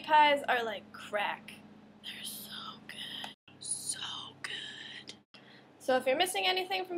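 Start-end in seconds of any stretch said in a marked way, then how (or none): 2.0-5.1 slow, hushed whisper of delight; reverent, drawn-out whisper; awed whisper. Acted. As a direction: whisper it slowly, savouring each word. Raving reverently about meat pies.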